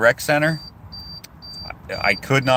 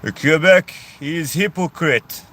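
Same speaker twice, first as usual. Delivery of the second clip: slow, higher mock French accent; French guy imitation; 6 semitones higher, 4 dB louder, slower than usual. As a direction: mimic the Frenchman scorning Quebec. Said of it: "he said".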